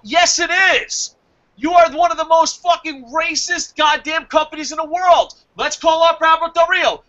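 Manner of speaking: high-pitched, emphatic rant; heated accusation. Real, not acted. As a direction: hit back hard, pitch right up. Ranting at the racist company.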